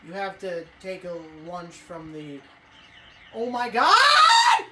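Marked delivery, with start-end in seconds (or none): 3.3-4.6 very high, very loud laughing scream; real shout. Real, not acted. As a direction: scream in shock at being hit. Getting killed in game.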